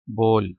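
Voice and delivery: बोल said as English "bowl", B-O-L vowel; बोल pronounced like English bowl, long o; English bol. Shown as a demonstration. Teacher showing students: बोल as English bowl. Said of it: "आपने English में पढ़ना है बोल".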